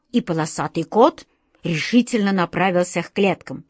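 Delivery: usual speaking voice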